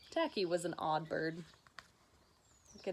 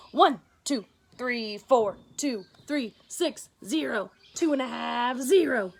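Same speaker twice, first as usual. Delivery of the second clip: wild offbeat counting; 3 semitones higher, 7 dB louder than usual. Acted. Tacky bellows his muddled count as he marches. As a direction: shout the numbers all out of order.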